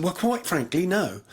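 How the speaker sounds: a singer's polite middle-class English refusal; put-on middle-class English accent; quasi-middle-class accent. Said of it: "in his, um, quasi-middle-class accent".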